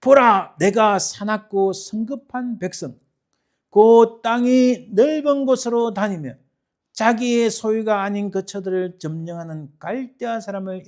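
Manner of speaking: loud, weighty proclamation; booming start, stretched phrases; God's solemn answer. Acted. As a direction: proclaim it like a divine decree. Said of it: "일 장 육 절에 이렇게 말씀합니다".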